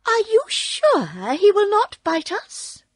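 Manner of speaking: high, timid mouse queen squeak. A narrator's reading. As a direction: ask nervously in a tiny high voice. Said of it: "asked in a timid voice".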